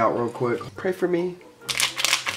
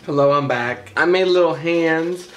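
ordinary talk

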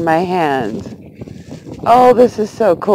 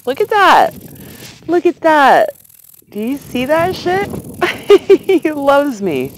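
delighted; 8 semitones higher than usual. Real, not acted. Bug returns to her hand.

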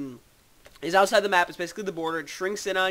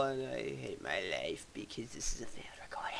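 whisper; 7 semitones lower, 14 dB quieter than usual. Real, not acted. Gamer mutters after failing.

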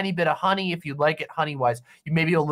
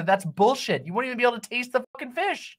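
ordinary delivery

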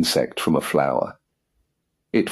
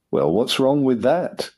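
higher, bitter retort; bitterly; 7 semitones higher than usual. A narrator's reading. Master snapping at his valet, bitterly. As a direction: spit it out in sour resentment. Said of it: "with extraordinary bitterness".

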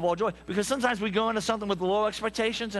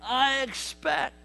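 high, slow, loud preacher's cry; preaching shout; 8 semitones higher, 3 dB louder, much slower than usual. Real, not acted. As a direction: stretch out I expect and holler it to the pews. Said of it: "preaching shout".

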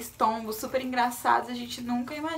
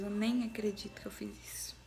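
soft whisper, to herself; whispered disbelief; 2 semitones lower, 6 dB quieter than usual. Real, not acted. To viewers, stunned she dyed her hair, whispering. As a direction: whisper it like she can't believe it.

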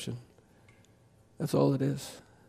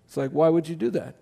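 usual speaking voice

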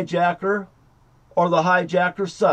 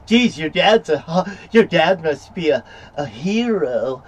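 slow, mildly mocking imitation of the cop; 2 semitones higher, 3 dB louder than usual. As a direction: drag it out like a lame brush-off. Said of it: "instead of going".

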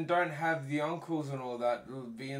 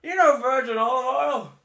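much higher, louder hammed-up dad; 11 semitones higher, 11 dB louder than usual. Dad explaining the taste to his son, hammed-up. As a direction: answer like a clueless bragging father. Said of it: "what does a virgin taste like, Dad?".

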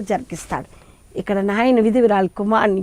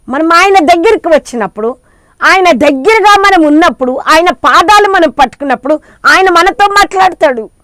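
louder, higher sermon cry; fervent preaching; 5 semitones higher, 5 dB louder than usual. Acted. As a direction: preach it with full fervour to the hall. Preaching at full force. (ఉత్సాహంగా బిగ్గరగా ప్రసంగం)

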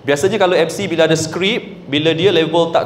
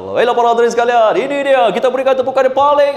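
fast, high, lively MC call; casual event hype; 6 semitones higher, faster than usual. Shown as a demonstration. MC at a relaxed outdoor programme, quick excited hype. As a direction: whip up the crowd, quick and high. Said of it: "dia dah boleh santai lah".

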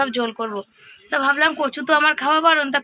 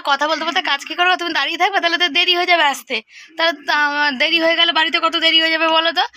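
louder, higher nagging at husband; exasperated wife scolding; retold scolding; 4 semitones higher, 5 dB louder than usual. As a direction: chide him for dawdling.

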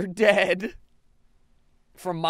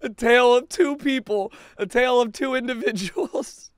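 louder, higher movie-trailer voice; hammed-up narrator; mock narrator; 5 semitones higher, 4 dB louder than usual. Real, not acted. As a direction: announce it like a trailer.

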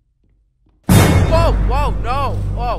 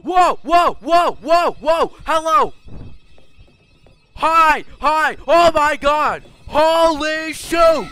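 panicked screaming; 13 semitones higher, 13 dB louder, slower than usual. Real, not acted. Panicked chase in game.

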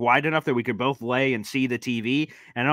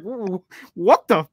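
stammering high sputter of shock; sputtering dad; 6 semitones higher, 4 dB louder, slower than usual. Retold or imitated. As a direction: stutter in disbelief. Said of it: "he gives something like".

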